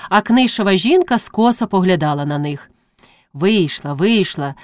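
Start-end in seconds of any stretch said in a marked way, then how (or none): none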